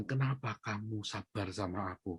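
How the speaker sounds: soft, humbled retold question; subdued repentance. Repentant husband at his wife's feet, softly voiced remorse. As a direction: ask it quietly, imitating a penitent husband. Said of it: "dia nangis suaminya ini bertobat".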